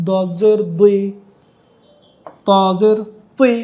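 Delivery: দুই and তুই with lips rounded into u. Shown as a demonstration. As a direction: round the mouth on each syllable. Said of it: "মুখটা sound গোল হয়ে যাবে".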